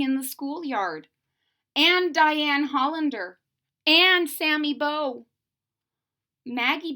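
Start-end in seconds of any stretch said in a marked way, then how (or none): none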